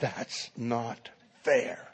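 restrained anger, low and slow; angry. Acted. Angry student repeating his protest to the professor, restrained. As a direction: spell out the grievance softly, word by word. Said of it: "And now he's angry".

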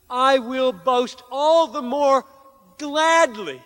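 high, loud, fervent declaration; impassioned proclamation. Shown as a demonstration. Preacher voicing Paul's glad boast in weakness, high fervent. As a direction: proclaim it with full joy. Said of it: "And respond like this".

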